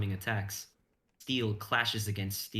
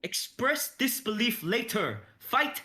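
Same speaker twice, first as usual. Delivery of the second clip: higher, louder, slow urgent orders; barked command; 13 semitones higher, 4 dB louder, slower than usual. Acted. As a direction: snap them back into the fight. Battle command to friends.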